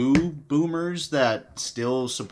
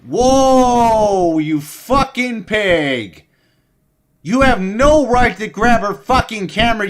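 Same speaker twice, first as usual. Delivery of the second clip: real outraged screaming at the screen, much louder and higher; furious yelling; 8 semitones higher, 11 dB louder, slower than usual. Real, not acted. Streamer screaming at the cop grabbing her camera, enraged. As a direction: blast it, livid, calling him a pig. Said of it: "I'm so mad".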